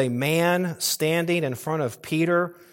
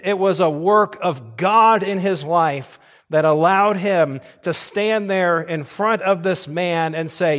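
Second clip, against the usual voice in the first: louder, higher climactic preaching; preaching at full force; 4 semitones higher, 5 dB louder than usual. Acted. Preacher proclaiming Peter's confession, rising to a loud climax. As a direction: drive it home to the congregation.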